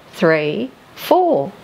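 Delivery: ordinary delivery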